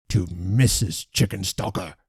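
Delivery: mild cold repetition, each word set apart; terribly distinct. A narrator's reading. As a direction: repeat it with cold precision. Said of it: "in the same tone as before".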